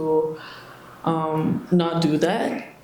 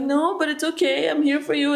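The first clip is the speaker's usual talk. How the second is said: higher, gentle reassuring voice; soothing reassurance; 9 semitones higher than usual. Retold or imitated. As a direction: reassure them tenderly, pitched up. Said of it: "you try to be like".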